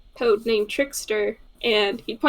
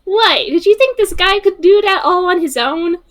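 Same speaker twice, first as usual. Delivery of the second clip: higher and louder, playfully offended; mock indignation; 7 semitones higher, 6 dB louder than usual. Player teases Sammy for doubting, higher and mock-offended. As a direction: act scandalised, half-laughing.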